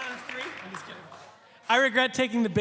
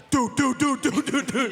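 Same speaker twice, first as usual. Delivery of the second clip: louder, urgent dude-dude-dude; frantic repeated dude; 3 semitones higher, 4 dB louder than usual. Retold or imitated. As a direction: tug at a friend's sleeve with your voice. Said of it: "my leader is just like".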